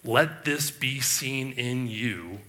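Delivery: slow, deliberate Let this be seen in you; each word of Let this be seen in you weighted; emphatic slow reading. Shown as a demonstration. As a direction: read it as an exclamation point. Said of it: "exclamation point".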